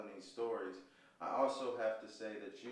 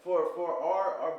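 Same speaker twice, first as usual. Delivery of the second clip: louder, higher fired-up speech; passionate; 6 semitones higher, 12 dB louder than usual. Real, not acted. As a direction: fire them up, much louder.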